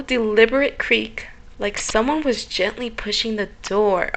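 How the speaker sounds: ordinary talk, nothing marked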